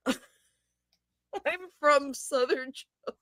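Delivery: slow, drawn-out Southern accent imitation; mock Southern accent. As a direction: stretch it slowly like a lazy Southern drawl. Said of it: "That was a Southern accent".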